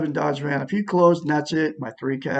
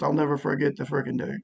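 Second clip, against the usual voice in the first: ordinary talk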